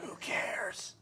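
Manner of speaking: soft dismissive whisper. Real, not acted. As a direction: whisper it with a shrug.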